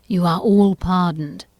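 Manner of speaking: plain reading voice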